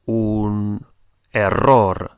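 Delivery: "error" said as Spanish, with rolled R; Spanish pronunciation. Shown as a demonstration. Teacher models words for learners to notice, Spanish pronunciation. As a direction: model "error" slowly the Spanish way, ending stressed. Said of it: "pay attention to the pronunciation".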